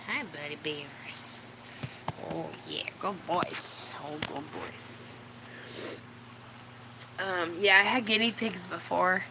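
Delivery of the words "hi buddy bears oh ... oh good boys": soft dog coo. Real, not acted. Cooing to dogs.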